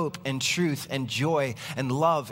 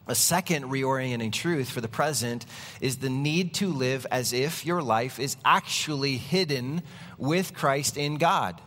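usual speaking voice

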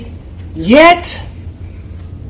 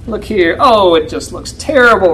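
ordinary delivery

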